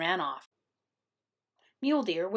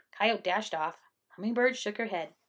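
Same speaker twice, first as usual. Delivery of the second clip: ordinary delivery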